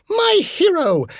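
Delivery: very high, loud swoon; swooning damsel. Retold or imitated. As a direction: gush it like a melodrama heroine. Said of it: "Polly Pureheart goes".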